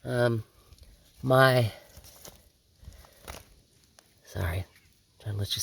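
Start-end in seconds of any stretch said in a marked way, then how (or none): 4.2-5.6 whispered sorry. Real, not acted. Aside while filming.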